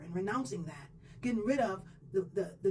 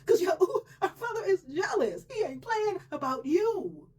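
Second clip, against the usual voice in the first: scared warning; 10 semitones higher, 5 dB louder than usual. Retold or imitated. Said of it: "why are you looking all scared".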